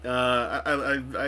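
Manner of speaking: ordinary talk, nothing marked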